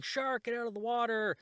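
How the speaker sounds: high-pitched imitation of a warning yell; mock yell. Ron yells from his boat to the beach, high mock yell. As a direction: call out a pretend alarm, pitched well up. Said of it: "starts yelling".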